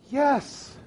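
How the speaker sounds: high, eager yes; light up at being understood; relieved yes. Retold or imitated. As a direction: neighbor bursts out a high yes. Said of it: "she went".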